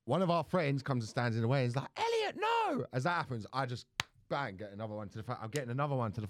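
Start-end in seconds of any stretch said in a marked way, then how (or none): 2.0-2.9 a sharp high yell to stop a friend; alarmed shout. Retold or imitated. Friend jumps between them, yelling the name. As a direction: cry out to stop him hitting.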